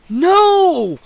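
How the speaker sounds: high, very loud scream of no; terrified shout. A narrator's reading. Scene: Freddy feels fangs on his throat, terrified scream. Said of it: "I screamed".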